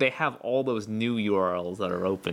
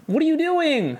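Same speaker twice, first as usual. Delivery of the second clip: high, loud startled cry; surprised; 6 dB louder than usual. Real, not acted. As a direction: blurt it out in shock. Startled by partner's antics.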